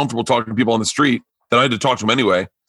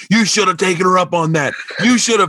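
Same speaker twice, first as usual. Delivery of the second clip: mocking friends; 6 semitones higher than usual. Comedian voicing friends scolding him for refusing the wine. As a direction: rub it in like jeering buddies.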